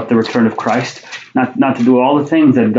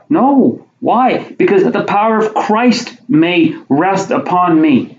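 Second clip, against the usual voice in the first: emphatic preaching; 6 semitones higher, slower than usual. Real, not acted. Preacher answers his own question.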